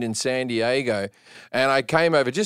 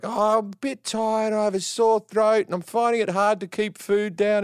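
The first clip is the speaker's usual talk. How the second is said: faking sick; 6 semitones higher than usual. Retold or imitated. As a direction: put on a feeble poorly voice to fool the doctor. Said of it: "I'm making up all these symptoms".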